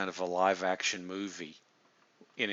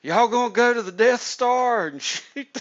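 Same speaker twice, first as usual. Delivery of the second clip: mock Southern accent; 12 semitones higher, 13 dB louder than usual. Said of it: "can't have a Southern accent".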